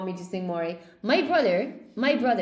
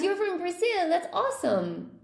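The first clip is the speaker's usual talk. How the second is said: ordinary delivery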